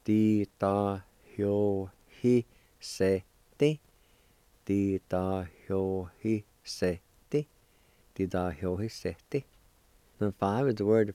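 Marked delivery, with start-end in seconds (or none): 0.0-3.8 very slow, a pause after every syllable; teacher sounding out a word piece by piece; syllable-by-syllable demonstration. Shown as a demonstration. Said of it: "and it's pronounced like this". 4.7-7.5 slow, separated syllables with short gaps; measured teaching pronunciation; slow pronunciation demonstration. Shown as a demonstration. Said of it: "it's pronounced like this".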